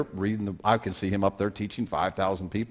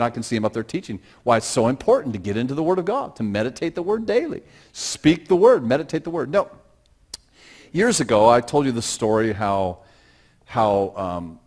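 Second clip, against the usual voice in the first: ordinary talk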